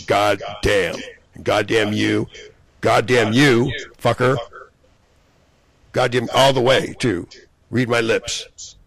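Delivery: real rage, shouting threats at his enemies; furious yelling. Real, not acted. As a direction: spit every curse at them. Furious cursing rant at enemies.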